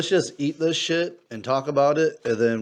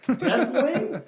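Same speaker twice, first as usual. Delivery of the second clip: sassy phone voice; 10 semitones higher, 4 dB louder than usual. Retold or imitated. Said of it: "That bitch was like".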